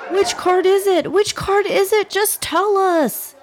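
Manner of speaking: put-on high, impatient children pleading; eager kids. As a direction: beg like bouncing first-graders. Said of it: "bouncing on their toes with eagerness".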